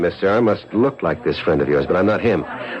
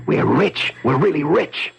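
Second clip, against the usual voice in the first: higher, rising joy on each line; thrilled; 10 semitones higher, slower than usual. Acted. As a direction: savour the windfall with delight. Hearing of a fortune.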